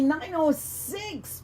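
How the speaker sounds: drawn-out radio time check, stress on "oh"; slow time call. Shown as a demonstration. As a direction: stretch out "nine oh six" slowly. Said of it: "it's nine oh six right here".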